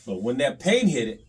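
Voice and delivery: usual speaking voice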